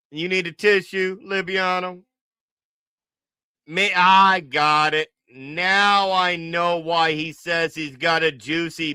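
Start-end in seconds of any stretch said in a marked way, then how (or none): none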